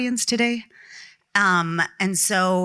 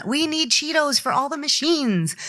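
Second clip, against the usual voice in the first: high-pitched playful demand; hammed-up needy request, pitched way up; mock demanding; 8 semitones higher than usual. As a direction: plead playfully for something trivial. Acts out silly demand to producer.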